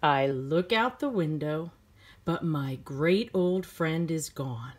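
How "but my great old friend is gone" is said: ordinary delivery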